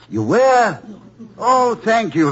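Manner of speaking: high, loud burst of surprised delight; delighted surprise. Acted. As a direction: gasp it out, overjoyed. Husband surprised by Europe trip.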